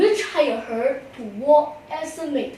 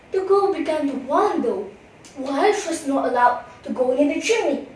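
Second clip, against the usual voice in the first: ordinary delivery, nothing marked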